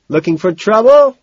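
high, loud, rising call; teasing challenge, shouted; shouted question. A narrator's reading. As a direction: holler the question over the music. Shouted exchange in noisy club.

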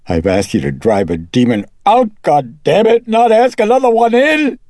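loud fury climbing to a high scream; furious outburst. A narrator's reading. Mother demanding an exorcism from the priest, furious.

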